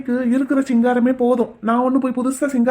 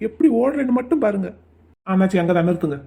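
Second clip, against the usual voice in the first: normal talking voice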